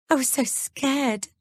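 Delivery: slow, high, tearful; sobbing. A narrator's reading. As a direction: sob the words out. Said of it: "she sobs".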